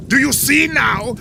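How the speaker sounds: gloating shout. Acted. Villain gloating at the heroes gathered at the pyramid. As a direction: crow it at them.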